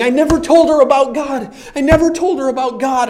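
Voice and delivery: high, loud anguished cry, acted; retold crying. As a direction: imitate a distraught, tearful outburst from a dream. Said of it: "crying, going".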